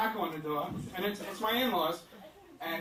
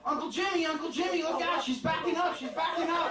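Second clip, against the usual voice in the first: put-on high-pitched, urgent kid warning; alarmed little nephew; 8 semitones higher than usual. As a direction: imitate a six-year-old calling out in alarm. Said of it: "Trent, who's six years old".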